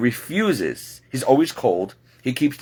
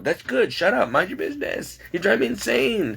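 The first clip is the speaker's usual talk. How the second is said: high, rising exasperated snap; fed-up retort; 11 semitones higher than usual. Retold or imitated. Answering the daily coat nag, a high fed-up snap. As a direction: tell the nagger to butt out. Snapping back at nagging coworker.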